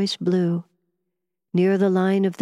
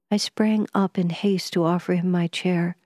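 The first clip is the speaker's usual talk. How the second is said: usual speaking voice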